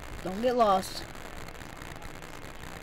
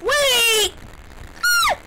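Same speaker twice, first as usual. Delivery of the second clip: startled yell; 12 semitones higher than usual. Real, not acted. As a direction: jump and yell in surprise. Startled in game.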